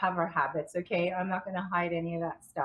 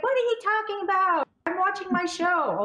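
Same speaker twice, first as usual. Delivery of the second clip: mom imitated, much higher and a bit louder; mom's voice; 11 semitones higher, 5 dB louder than usual. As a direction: play the mother brushing her off, squeaky and high. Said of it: "She's like".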